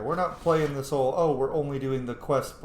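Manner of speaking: ordinary talk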